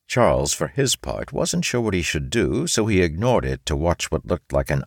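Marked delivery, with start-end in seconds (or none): none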